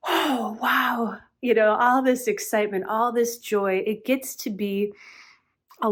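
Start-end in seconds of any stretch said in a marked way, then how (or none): 0.1-1.2 breathless gasp, then an awed, higher "oh, wow"; overwhelmed by the rush, higher pitched; hyped up. Acted. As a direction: gasp it out, amazed and overexcited. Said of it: "I was just really hyped up".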